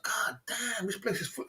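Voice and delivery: soft, hushed amazement; muttered to himself. Retold or imitated. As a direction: say it under your breath, awed. Awed thought said to himself.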